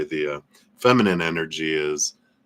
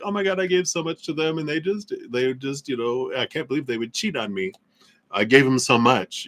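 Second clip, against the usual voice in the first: much higher and fast, a hammed-up wronged lover's whine; whiny hurt partner; 7 semitones higher than usual. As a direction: whine like the betrayed overgiver. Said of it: "And I'm like".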